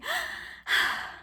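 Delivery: long airy exhale; heavy sigh. Real, not acted. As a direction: let out a big sigh. Sighs at the deadly rite.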